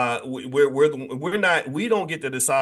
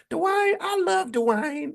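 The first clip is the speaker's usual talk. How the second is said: much higher, slow, swooning sitcom voice; Whitley Gilbert impression; much slower than usual. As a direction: stretch it out high like a lovestruck TV character. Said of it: "remember how she talked".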